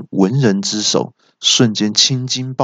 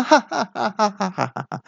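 high, gloating cackle; villain laugh; 11 semitones higher, 5 dB quieter than usual. A narrator's reading. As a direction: cackle high after a boast. 恶怪夸口后大笑. (得意尖声大笑)

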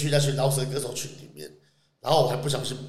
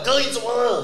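higher concerned little-brother voice; concerned younger guy; 9 semitones higher than usual. Retold or imitated. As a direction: ask it like a worried kid brother. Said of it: "两个弟弟直接走过来".

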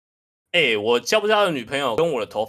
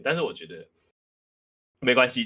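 ordinary talk

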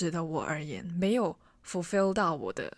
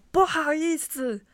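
long drawn-out call, pitched up high; slow, high yell for attention; retold shout; 8 semitones higher, 4 dB louder than usual. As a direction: call out across a floor below. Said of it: "我就大喊说".

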